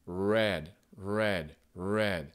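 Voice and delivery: red with the American R made at the tongue tip. Shown as a demonstration. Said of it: "avec la pointe de la langue".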